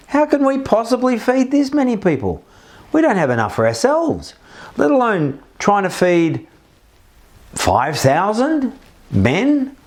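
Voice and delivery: high, panicked disbelief, hammed up a little; horrified disciples. Disciples facing five thousand hungry people, mock horrified. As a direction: gasp out the impossibility, mock panic. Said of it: "the horror that they would have felt".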